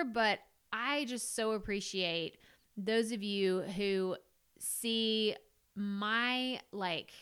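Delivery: usual speaking voice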